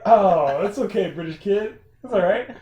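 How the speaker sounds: loud, high soothing dad; reassuring parent. Retold or imitated. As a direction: comfort the little one cheerily. Parent soothing British kid.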